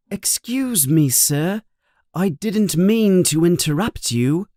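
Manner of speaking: a hint of a timid boy; young boy voice. Harry voiced by narrator.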